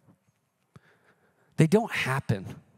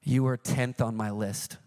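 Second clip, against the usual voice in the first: a lower, dry divine voice; deadpan Holy Spirit; 8 semitones lower than usual. Retold or imitated. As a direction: drop the punchline dryly. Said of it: "the Holy Spirit goes".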